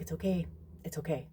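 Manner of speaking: hushed, gentle repeat; soft reassurance. Retold or imitated. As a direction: soothe her softly. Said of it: "I'm like".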